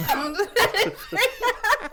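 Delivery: slow, high, laughing protest; laughing plea. Real, not acted. Friend begging the teller to stop, laughing. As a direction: beg him to stop through laughter.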